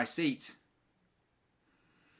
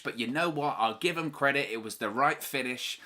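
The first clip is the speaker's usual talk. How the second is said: ordinary delivery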